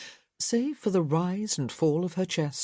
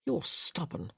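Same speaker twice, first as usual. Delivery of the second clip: much softer grumble; muttered; 5 dB quieter than usual. A narrator's reading. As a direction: mutter it half to yourself. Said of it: "he muttered".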